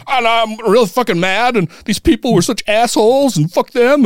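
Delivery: high-pitched mock ranting; retold anger. Both venting to friends at the table, mock angry rant. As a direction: imitate being worked up, pitched high without shouting. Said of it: "we're both just like".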